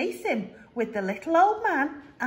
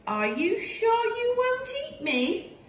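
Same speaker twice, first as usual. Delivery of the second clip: high, doubtful cookie voice; gingerbread man voice; 11 semitones higher than usual. Acted. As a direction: ask warily, up high.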